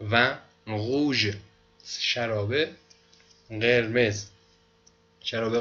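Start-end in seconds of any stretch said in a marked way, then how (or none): 0.1-1.3 و روژ stretched slowly; slow French word. Shown as a demonstration. (و روژ را کشیده بگو)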